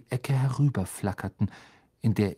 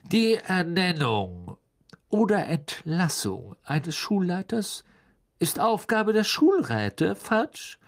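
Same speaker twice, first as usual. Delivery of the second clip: higher-pitched, offhand superiority; casual; 9 semitones higher than usual. A narrator's reading. Governor putting the minister in his place, casually. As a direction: drop the rule casually, as if obvious. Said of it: "sagte Mister Malfoy beiläufig".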